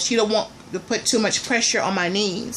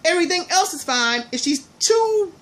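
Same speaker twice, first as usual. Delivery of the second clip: exasperated; 8 semitones higher than usual. Real, not acted. Exasperated rant about her conditions.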